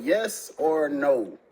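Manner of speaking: very slow, each word laid down; slow demand. Retold or imitated. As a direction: drag it out so she can't dodge. Demanding an answer.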